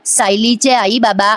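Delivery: very slow, each word drawn out; slow motion. Retold or imitated. Ailing father-figure speaking to son-in-law in slow motion. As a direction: stretch it like a slowed-down TV moment. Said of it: "असं जरा slow motion मध्ये ऐकवलं".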